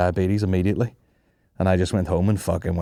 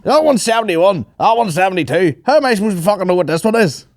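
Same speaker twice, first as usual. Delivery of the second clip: high, loud hammed-up frustrated courier; mock exasperated driver; 10 semitones higher, 6 dB louder than usual. Driver complaining he can't find the house, high loud mock rant. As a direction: rant like a lost delivery man. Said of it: "He's like".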